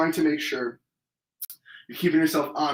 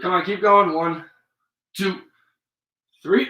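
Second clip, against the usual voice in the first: loud coaching; 5 dB louder than usual. Real, not acted. Coach urging on during push-ups.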